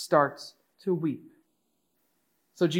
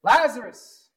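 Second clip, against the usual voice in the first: loud, high commanding call; 8 semitones higher, 9 dB louder than usual. Retold or imitated. Jesus calling dead Lazarus out of the tomb, loud voice. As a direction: call out forcefully, word by word. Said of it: "he says with a loud voice".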